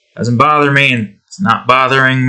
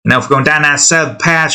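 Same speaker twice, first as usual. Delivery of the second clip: ordinary talk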